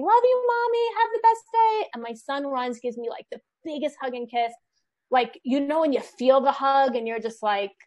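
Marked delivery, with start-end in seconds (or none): 0.0-1.9 put-on high, sing-out little girl voice; daughter calling goodbye. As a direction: imitate a child calling out a sweet goodbye. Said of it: "as my daughter's waving".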